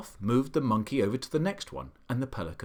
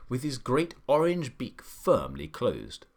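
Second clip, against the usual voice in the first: usual speaking voice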